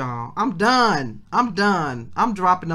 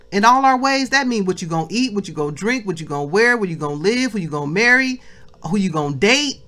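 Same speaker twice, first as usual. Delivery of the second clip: louder, driving sermon voice with punched words; fired-up preaching; 5 dB louder than usual. Acted. Preacher exhorting the family to acknowledge God, louder. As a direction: preach it at full force to the congregation.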